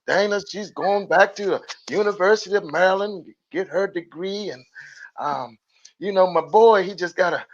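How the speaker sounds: a mother boasting about her daughters, a touch put-on; bragging mother. Son imitating his mother bragging to a friend, lightly voiced proud mom. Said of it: "my mother used to brag on my sisters".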